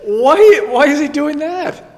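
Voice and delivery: high, loud disbelieving question; a friend stunned by the story, high and loud; incredulous. Real, not acted. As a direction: ask it in real disbelief, laughing.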